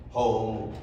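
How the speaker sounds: ordinary delivery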